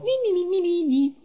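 very high falsetto "ni ni ni"; imitated squeaky chatter. Player shows how they go along, squeaky chatter. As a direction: squeak a rapid tiny string of nonsense. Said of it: "van ahí como".

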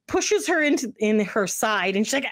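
normal talking voice

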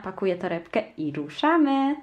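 ordinary delivery

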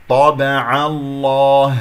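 طابع الله with a thin, light ع; light ain demo. Shown as a demonstration. Teacher slowly modelling the light ع in طابع الله. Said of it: "هكذا العين مرققة".

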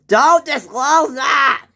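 slow, high, loud scolding shout; yelling boss. Acted. Boss telling off the worker, yelling. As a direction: blast it out in outrage. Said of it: "he yells at me a lot".

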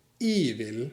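"อีวิล" said slowly as "i vil"; dialect variant "i vil". Shown as a demonstration. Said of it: "พวกเขาจะพูด". (ออกเสียง "อีวิล" ช้าๆ)

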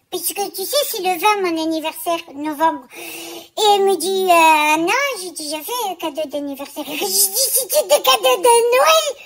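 a quirky small-business lady complaining to a friend; Germaine character voice. Acted. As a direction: play a fussy chatty seamstress. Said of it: "c'est toute Germaine".

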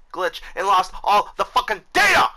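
loud, high-pitched frustrated shout; full-on furious rant peak; angry yelling. Real, not acted. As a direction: yell in exasperation at the top of your voice. Raging over lost game data.

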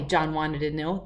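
ordinary delivery

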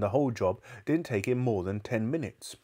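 ordinary delivery, nothing marked

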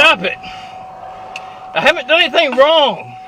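loud, slow, drawn-out protest; shouting. Real, not acted. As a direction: yell out your innocence. Driver protests innocence.

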